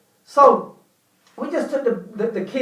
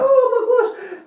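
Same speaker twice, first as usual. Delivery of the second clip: wife's shocked exclamation; 13 semitones higher, 4 dB louder, slower than usual. Retold or imitated. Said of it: "My wife was like".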